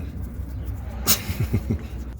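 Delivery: soft huff of a laugh; quiet breathy laugh. Real, not acted. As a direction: chuckle under the breath. Laughs at his own joke.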